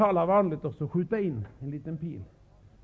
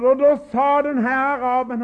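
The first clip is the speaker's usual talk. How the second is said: ordinary talk, nothing marked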